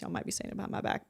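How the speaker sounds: fast whispered joke; whispered aside. Real, not acted. To the listeners, a quick joking whisper. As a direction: let it slip out as a whisper.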